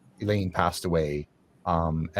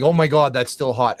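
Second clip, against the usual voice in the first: a sudden loud, higher Oh my God; startled cry; 5 semitones higher, 8 dB louder than usual. Retold or imitated. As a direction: recoil as if burned, voice jumping up. Retold shock at hot coal.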